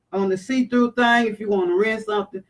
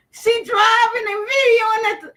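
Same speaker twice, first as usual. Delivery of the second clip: high, crying shout; pitched way up in mock panic; a yelped half-cry of alarm; frantic retelling; 12 semitones higher, 3 dB louder than usual.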